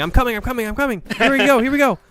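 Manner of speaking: high-pitched mock scream of panic; excited, high mock shouting; imitated screaming. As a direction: imitate a teammate yelling urgently as he rushes over. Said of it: "I'm sure Kevin was screaming".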